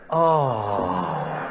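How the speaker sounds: a low, drawn-out pitying oh; mock sympathetic oh. Grief group reacting to the dead dog, mock pitying oh. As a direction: groan it like a crowd full of pity.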